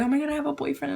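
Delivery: high, giddy imitation; jittery excitement. Announcing her first boyfriend, jittery and excited. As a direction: gush it in a high voice. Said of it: "all jittery".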